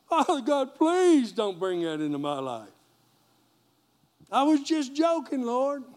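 high, loud pleading to God; mock panicked prayer. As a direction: beg the Lord in a hammed-up panic.

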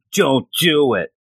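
normal talking voice

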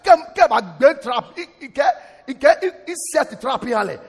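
excited sermon shout in Twi; fiery outburst. Real, not acted. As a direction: let it burst out in a passionate rant. Preacher breaks into heated local-language riff.

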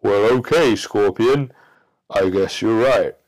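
loud, hesitant agreement; reluctant frog. Acted. Frog reluctantly agreeing to carry the scorpion. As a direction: give in, unsure. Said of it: "The frog says".

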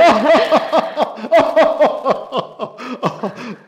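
high, loud burst of laughter; hearty laugh. Real, not acted. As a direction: laugh out loud at the silly names. King laughs heartily at names.

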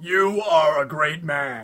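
slow, low motivational-guru impression; Tony Robbins. Co-host as motivational speaker praising a man, slow and deep. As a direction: intone it like a big self-help speaker. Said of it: "You like Tony Robbins, right?".

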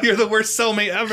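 high, loud comic outrage; retold indignant yelling. Scolding his sleeping cellmate, retold indignant yell. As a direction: complain loudly with laughter underneath. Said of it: "I was so mad".

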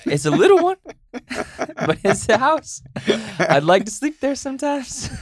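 high, squeaky little voice; mock-plaintive. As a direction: plead in a tiny high-pitched voice. Joking plea about own little house.

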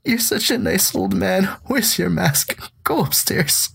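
high-pitched, squeaky pleading; pitying fussing. Retold or imitated. Teller to the nice old man without a mask, high pitying fussing. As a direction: fuss over him in a high, pitying voice.

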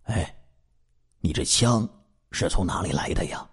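careful, hushed question with hesitant gaps; quiet, tentative inquiry; tentative probing. A narrator's reading. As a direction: softly feel them out. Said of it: "他试探地问道".

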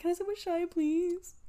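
mock weepy ask; tearful plea. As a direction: beg like a crying kid. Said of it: "I was like".

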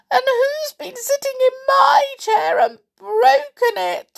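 very high, loud little-bear wail; Little Bear's sad squeak. A narrator's reading. Little Bear finding his chair broken, sad squeak. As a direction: squeak it out in childish distress. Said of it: "squeaked Little Bear sadly".

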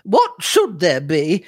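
high, drawn-out sharp retort. A narrator's reading. Aunt rebuffs Cynthia's concern, slow and sharp. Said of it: "said Missus Singlethorpe sharply".

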